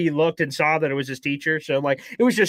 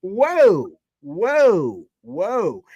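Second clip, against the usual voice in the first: loud high "whoa" that settles down; calming the crowd; 7 semitones higher, 4 dB louder, much slower than usual. Retold or imitated. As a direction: hold the people back, then ease off. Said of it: "I'm like".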